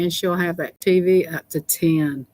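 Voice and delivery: normal talking voice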